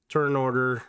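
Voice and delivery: ordinary talk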